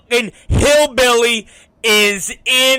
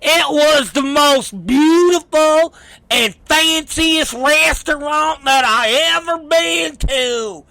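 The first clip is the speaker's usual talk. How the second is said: mock awestruck hillbilly; 7 semitones higher than usual. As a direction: gush like a yokel seeing luxury. Mocking the hillbilly's awe.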